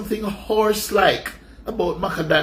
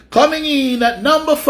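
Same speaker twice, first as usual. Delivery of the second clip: countdown announcer; 6 semitones higher, 4 dB louder than usual. Acted. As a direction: announce it like a chart show host, stretching the words.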